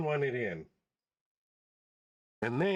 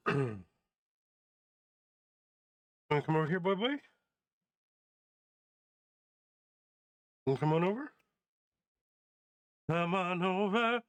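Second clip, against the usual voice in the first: coaxing dog; 4 semitones higher than usual. Real, not acted. Coaxing dog to come over.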